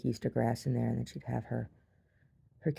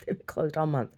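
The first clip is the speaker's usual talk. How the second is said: ordinary talk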